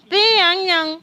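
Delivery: "Pi yang yang" said as pee-yang-yang, first syllable stressed; Chinese phrase demo. Shown as a demonstration. Host showing the room how to say "Pi yang yang", stressing "Pi". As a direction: model "Pi yang yang" clearly with a push on "Pi". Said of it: "Noël en chinois".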